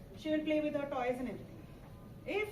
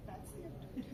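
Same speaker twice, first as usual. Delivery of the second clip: unvoiced whisper, much softer; 3 semitones higher, 10 dB quieter, slower than usual. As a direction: breathe it as a whisper. Whispered repeat.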